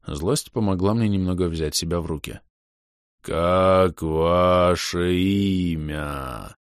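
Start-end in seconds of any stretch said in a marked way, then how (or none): 3.2-6.6 very slow, eerie distorted voice. Acted. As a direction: eldritch horror, drawn out slowly. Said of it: "жутко искажённый голос".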